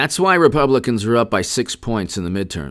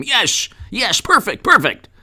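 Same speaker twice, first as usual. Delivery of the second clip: gushing approval; 9 semitones higher than usual. Retold or imitated. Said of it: "the same person who said".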